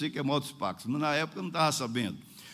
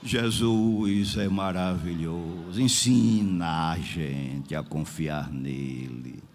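very low, slow, solemn declaration; solemn exhortation; 12 semitones lower, much slower than usual. Real, not acted. Preacher tells the church to trust Jesus, low and solemn. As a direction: intone it slowly in a deep, low voice. Solemn aside after tongues.

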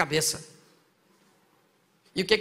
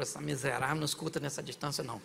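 muttering aside; 6 semitones lower, 10 dB quieter than usual. Retold or imitated. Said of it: "Ele falou".

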